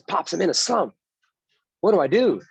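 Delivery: a grown son whining to his dad; pleading son. Retold or imitated. Son begs his famous father for help, pleading high voice.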